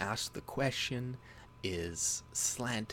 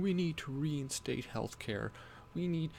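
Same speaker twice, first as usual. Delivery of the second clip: higher-pitched mock pleading; lightly put-on tearful voice; mock tearful plea; 6 semitones higher than usual. Mocking a TV host pleading over healthcare, mock tearful. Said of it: "crying and shit and saying".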